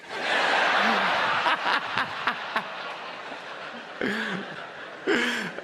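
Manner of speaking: high soft laugh. Real, not acted. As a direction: giggle at your own punchline. Laugh at own joke.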